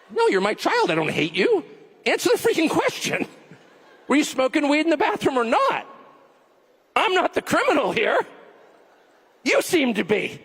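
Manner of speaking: high, insistent parental comeback; lightly acted exasperated parent. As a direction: lightly play a parent demanding an answer. Acts parent confronting the kid.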